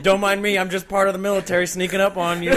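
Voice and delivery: ordinary talk, nothing marked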